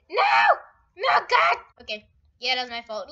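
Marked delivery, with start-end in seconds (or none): 0.1-1.6 very high, loud cry of dismay; panicked shout. Real, not acted. Player watching his own mishap, panicked shout. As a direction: scream it in alarm.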